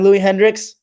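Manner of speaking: ordinary talk, nothing marked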